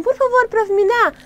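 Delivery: put-on high, eager kid asking the teacher; high childish plea. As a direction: ask like a child who wants candy. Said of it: "alguém vai falar assim".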